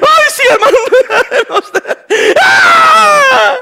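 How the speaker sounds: squealing, high, hammed-up sympathy with a laugh; mock pitying coo. Pastor mocking what people want to hear, high whiny squeal. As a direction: overdo the syrupy pity for a laugh. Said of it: "quieren que yo le diga".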